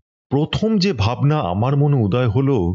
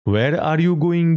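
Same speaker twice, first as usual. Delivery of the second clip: very slow, higher, careful English "Where are you going?"; slow correct British phrasing of "Where are you going?"; proper English; 6 semitones higher, much slower than usual. Shown as a demonstration. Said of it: "বিলিতি কায়দা জানে".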